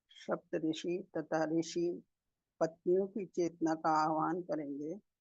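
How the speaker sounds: soft, unhurried meditation guidance with long pauses; guided meditation. Real, not acted. Meditation leader guiding masters to sit and breathe, calm guided meditation. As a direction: settle the group gently into stillness, phrase by phrase.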